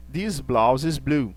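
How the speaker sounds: slow, careful English example; slow teaching demo. Shown as a demonstration. Teacher reading an example to students, slow and careful. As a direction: spell the sentence out slowly for the class. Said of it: "Veja que vem o verbo be".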